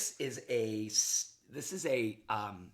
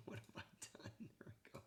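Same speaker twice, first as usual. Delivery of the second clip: half-laughing whisper to himself; sheepish whispered mutter; whispered aside; 21 dB quieter than usual. Real, not acted. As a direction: whisper it under your breath while fixing the mistake. Fumbling with the video, muttering.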